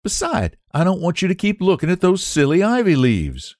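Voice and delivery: put-on high, bright young woman's voice; young Sue. Sue's voice in the story.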